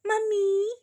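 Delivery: high, loud excited calls; shouting for mom. A narrator's reading. Daughters rush home shouting for their mother, high and loud. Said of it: "కూతుర్ల అరుపుకి".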